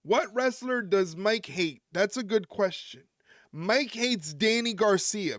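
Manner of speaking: ordinary talk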